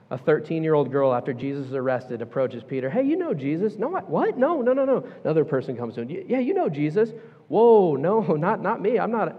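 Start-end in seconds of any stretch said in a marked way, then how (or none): 2.9-3.8 put-on high, accusing young girl's voice. Girl accuses Peter. 3.8-5.0 very high, flustered denial; panicked denial. Retold or imitated. Peter panics and denies. 6.1-7.1 put-on high, accusing young girl's voice. Second accuser. 7.5-9.3 high, louder, laughing it off nervously; nervous denial. Retold or imitated. Peter nervously denies.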